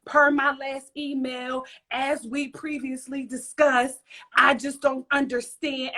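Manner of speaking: slow, drawn-out mock email voice; exaggerated word-by-word office-speak; mocking imitation. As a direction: imitate someone mimicking your emails with attitude. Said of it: "that's just how it sounds".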